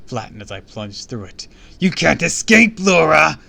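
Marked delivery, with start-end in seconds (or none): 1.8-3.4 loud, high-pitched monster threat; beastly growl. A narrator's reading. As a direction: bellow it as the possessed chaser. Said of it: "I growled".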